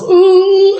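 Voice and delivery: high, loud straining ooh; mock strained groan. Luke straining at the X-Wing, a loud high groan. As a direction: groan like lifting something too heavy. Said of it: "and he goes".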